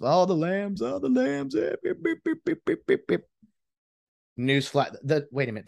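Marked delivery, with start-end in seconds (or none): 0.0-1.6 high, sing-song taunting fan voice; mock the Rams like a rival fan; jeering Niners fan on Facebook. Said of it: "I'll get all the Facebook". 1.6-3.2 high-pitched taunting beeps; mock beeping. Imitating taunt noise.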